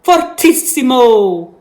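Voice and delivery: "Fortissimo" with a trilled, rolled R; teacher playing with a rolled R. Shown as a demonstration. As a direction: roll the R in "Fortissimo". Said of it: "I roll my R".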